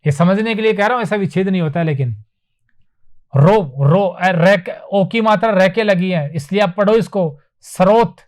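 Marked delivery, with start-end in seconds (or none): none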